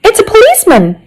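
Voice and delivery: high-pitched exclamation of discovery; excited cry. A narrator's reading. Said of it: "I cried".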